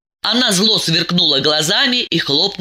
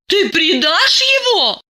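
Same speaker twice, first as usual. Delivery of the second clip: high, slow, enraged question; rising fury at betrayal; furious; 14 semitones higher, slower than usual. A narrator's reading. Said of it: "пришла в ярость Диана".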